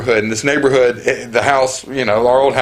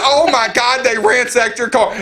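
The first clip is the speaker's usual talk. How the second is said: high, horrified cry; mock alarm, higher pitched; shocked neighbor; 8 semitones higher than usual. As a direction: do her alarmed disbelief. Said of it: "She said".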